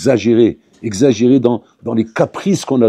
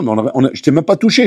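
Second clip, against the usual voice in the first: usual speaking voice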